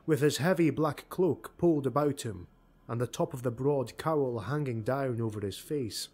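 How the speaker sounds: ordinary delivery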